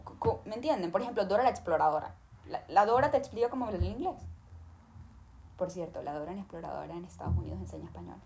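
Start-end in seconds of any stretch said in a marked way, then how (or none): 5.6-8.2 quick whispered side note; whispered aside. Real, not acted. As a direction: whisper it fast, as a by-the-way. Side remark dropped into the lesson.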